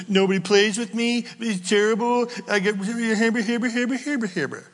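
lightly voiced sulky complaint; whiny Elijah. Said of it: "And he names them".

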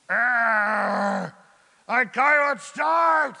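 loud, high, grumbling complaint; grumpy frustrated shout. Retold or imitated. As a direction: moan loudly like a stranded driver. Mocking a frustrated commuter.

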